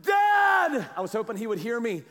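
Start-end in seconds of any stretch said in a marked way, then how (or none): 0.0-0.9 loud, high, drawn-out shout; desperate cry out. Retold or imitated. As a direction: yell for Dad like a lost kid in the woods. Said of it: "I cry out".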